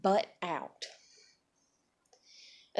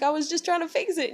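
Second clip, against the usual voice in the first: high-pitched mock protest; defensive man; 7 semitones higher, faster than usual. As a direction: plead innocence in a raised voice. Man's defensive reply in acted quarrel.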